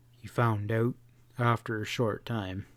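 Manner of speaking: ordinary delivery, nothing marked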